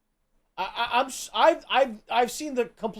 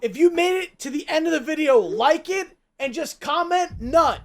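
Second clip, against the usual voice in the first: outro announcer; 7 semitones higher, 7 dB louder than usual. Real, not acted. Channel outro.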